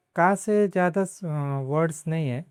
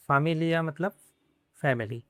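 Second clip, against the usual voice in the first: usual speaking voice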